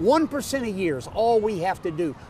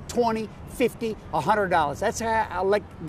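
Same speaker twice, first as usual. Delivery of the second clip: ordinary talk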